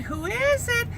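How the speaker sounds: put-on high frail old lady. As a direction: call out like a tiny old widow. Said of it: "And Missus Fontenot said".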